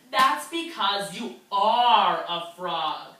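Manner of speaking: slow firm frog reveal to guppy. Acted. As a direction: lay it out slowly as the frog.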